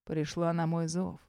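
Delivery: едва слышно, ниже обычного; губами, почти без звука; почти беззвучно. Acted. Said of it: "произносят почти беззвучно". (почти беззвучно)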